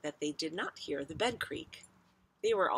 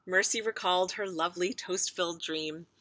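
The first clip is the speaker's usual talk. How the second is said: plain reading voice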